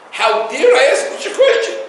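mocking the offended man through laughter; laughing mock outrage. Rabbi laughing while retelling the man's outrage. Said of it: "tells somebody how upset he was".

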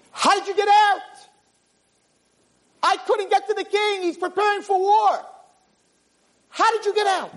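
very high incredulous squeal; astonished. Acted. Minister meeting the freed prisoner, astonished. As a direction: squeak it out in sheer disbelief.

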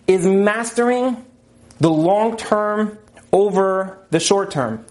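slow, deliberate, each word weighed; slow emphasis. Shown as a demonstration. Teacher stresses the key idea to the class, slowly. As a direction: lay it out slowly so it sinks in. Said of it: "I'll say that again".

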